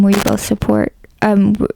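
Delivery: normal talking voice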